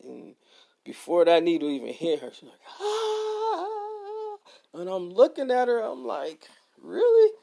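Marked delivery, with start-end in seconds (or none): 2.8-4.4 high mock scream of a scared kid; imitated child's scream. Dad imitating his daughter's high scream before the shot. 6.8-7.3 high, loud disbelieving really; incredulous. Retold or imitated. Dad teasing his daughter who screamed too soon, incredulous.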